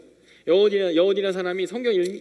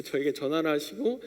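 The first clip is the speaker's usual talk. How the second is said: ordinary talk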